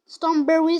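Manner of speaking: loud teaching repeat; English word. Shown as a demonstration. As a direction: repeat Strawberry loud and proud. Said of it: "ça veut dire fraise".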